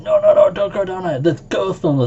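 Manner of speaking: very high, loud alarmed no; alarmed warning. Retold or imitated. Relatives stopping nine-year-old from basement, high alarmed cry. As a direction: stop the child in panic. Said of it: "my relatives were, like, saying".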